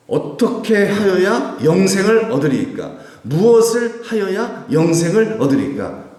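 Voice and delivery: high, loud and slow, stretched-out delivery; declaimed challenging question. Retold or imitated. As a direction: declaim it as a testing challenge to a teacher. Acts out law teacher's testing question.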